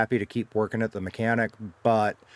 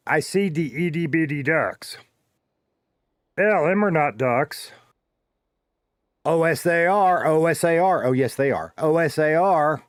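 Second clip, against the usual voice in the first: slow, hammed-up Southern Texas accent, higher pitched; 6 semitones higher, 4 dB louder than usual. Reading the riddle to chat in a mock Southern Texas accent. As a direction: drag the letters out in a put-on Texas twang. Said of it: "say each letter in a deep Southern Texas accent".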